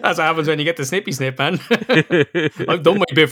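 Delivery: joking about his vasectomy, laughing. Real, not acted. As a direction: laugh your way through the joke.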